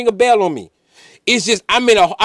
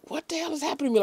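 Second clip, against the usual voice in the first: high, hushed alarm; retold panic in a small high voice; freaked-out question, high and under the breath; freaking out; 7 semitones higher, 12 dB quieter, much faster than usual. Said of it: "I'm freaking out".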